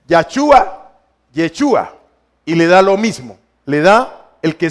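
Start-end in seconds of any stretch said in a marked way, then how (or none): 0.1-0.9 Yachua said as "ya-CHU-a", rising; vowel variant. Shown as a demonstration. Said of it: "usted puede decir". 1.3-2.0 Yechua said as "ye-CHU-a"; Yechua, lower, opening on "ye"; vowel variant. Shown as a demonstration. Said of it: "usted puede decir".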